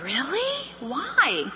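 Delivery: soft, high, swooping questions; mock puzzlement. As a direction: feign gentle surprise with a soaring rise. Director questions complaining consultant.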